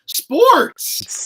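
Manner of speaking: high, loud, drawn-out SPORTS; mock-triumphant yell of SPORTS; big punchline shout. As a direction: belt out SPORTS like a payoff. Punchline after repeated "wait for it.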